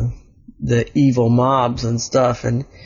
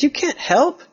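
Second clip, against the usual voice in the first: much higher squeaky character voice; squeaky kid voice; 13 semitones higher than usual. Acted. Elliot telling Oliver he can't help, squeaky. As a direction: pitch it way up for Elliot.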